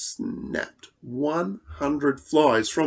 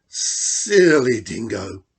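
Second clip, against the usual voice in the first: slow, louder hiss; hissing lizard; 2 semitones lower, 5 dB louder than usual. Acted. Blue Tongue scolds Dingo, slow hissing lizard. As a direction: drag the words out like a lizard. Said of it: "he hissed".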